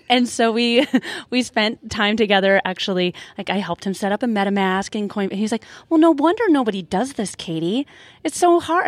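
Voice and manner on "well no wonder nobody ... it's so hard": much higher, whiny complaint; mock exasperated husband. Husband groaning to Katie about wallet setup, mock exasperated. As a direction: imitate a fed-up husband griping that it's hard.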